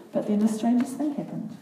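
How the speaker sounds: ordinary delivery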